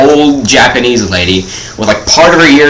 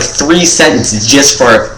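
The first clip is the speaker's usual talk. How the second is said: ordinary talk, nothing marked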